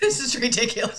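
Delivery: real high, tearful laugh at oneself; near tears. Real, not acted. Teller overcome by the grocery story, real near-tears voice. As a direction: push through rising tears, voice climbing. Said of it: "I'm gonna start crying".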